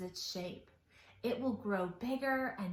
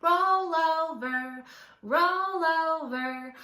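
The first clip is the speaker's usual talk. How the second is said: slow, louder roll over call; bear's command; 5 semitones higher, 6 dB louder, slower than usual. A narrator's reading. Brown bear telling the others to roll over, slow and loud. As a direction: draw out each roll over like a grumpy bear. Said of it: "the brown one said".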